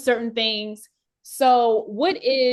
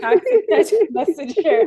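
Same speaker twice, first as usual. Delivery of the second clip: delighted echo; 8 semitones higher, 6 dB louder, slower than usual. Real, not acted. Host delighted by the phrase.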